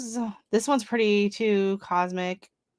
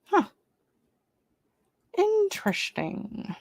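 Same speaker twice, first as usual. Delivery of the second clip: intrigued; 11 semitones higher, slower than usual. Real, not acted. Musing over a plant.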